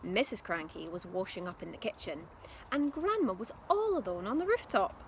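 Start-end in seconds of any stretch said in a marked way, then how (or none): none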